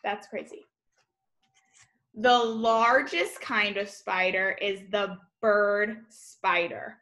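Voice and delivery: ordinary talk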